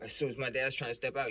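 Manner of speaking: normal talking voice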